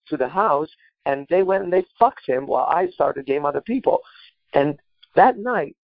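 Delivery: ordinary delivery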